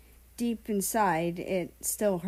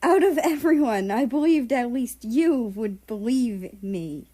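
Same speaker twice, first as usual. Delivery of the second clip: a betrayed friend shouting while crying; angry through tears; 6 semitones higher, 9 dB louder than usual. Acted. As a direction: cry it out in hurt fury. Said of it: "America angrily said as he cried".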